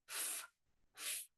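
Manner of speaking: F and f as breathy unvoiced f sounds. Shown as a demonstration. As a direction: blow out a soft F, f. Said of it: "What does frog start with".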